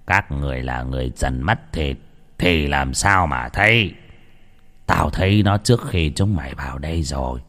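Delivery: a grim sorcerer warning in hushed low tones; old shaman. Acted. Shaman telling the women about the ghost, low ominous voice.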